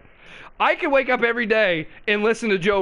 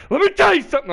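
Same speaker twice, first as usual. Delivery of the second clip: louder, higher barked start; mock rant opener; 8 semitones higher, 5 dB louder, much faster than usual. Host fakes starting a Joe-style rant, loud. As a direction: pretend to launch into a rant. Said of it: "I'm just kidding".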